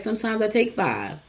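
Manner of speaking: ordinary delivery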